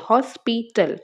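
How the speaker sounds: "Hospital" in three detached beats, hos-pi-tal; syllable by syllable. Shown as a demonstration. Teacher counting three parts, "hospital" said syllable by syllable. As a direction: separate each part of "hospital": hos, pi, tal. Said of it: "তিনটা পার্টে বলতে হচ্ছে".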